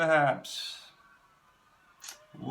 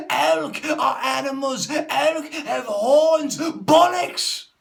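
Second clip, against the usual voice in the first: much higher, much louder shouting; furious yell; 11 semitones higher, 11 dB louder, much slower than usual. Acted. Exploding about elk horns, much higher furious yell. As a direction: explode in frustration. Furious outburst ending rant.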